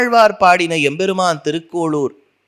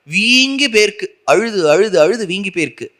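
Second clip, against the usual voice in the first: normal talking voice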